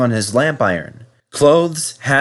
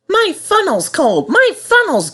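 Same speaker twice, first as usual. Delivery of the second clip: much higher little engine voice, whiny; Percy complaining; 4 dB louder than usual. Acted. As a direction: whine it like a small cold engine. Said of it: "he would puff".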